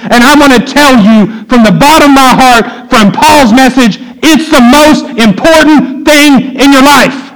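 high, emphatic, building sermon delivery; full-force preaching. Real, not acted. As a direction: blow the warning horn from the pulpit.

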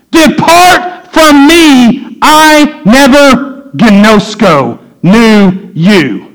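very slow, high start, weighted word by word; solemn divine judgment. Retold or imitated. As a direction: pronounce the sentence slowly from the pulpit. Quoting Jesus at the judgment.

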